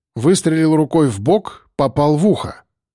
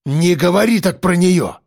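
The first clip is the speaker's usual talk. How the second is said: higher, heated protest; contained outburst of anger; exploding in anger; 4 semitones higher than usual. A narrator's reading. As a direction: defend her angrily. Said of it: "взорвался Андрей".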